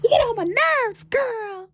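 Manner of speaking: very high, loud squeal at a pet; playful mock scolding. Owner tells off her too-cute little dog, squealed mock scolding.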